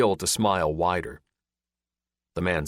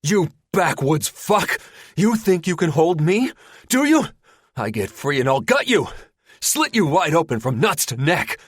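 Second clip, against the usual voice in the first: loud, much higher threats; enraged captive bellowing; furious yelling; 10 semitones higher, 6 dB louder than usual. Acted. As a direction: spit every threat at him. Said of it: "Linder yells".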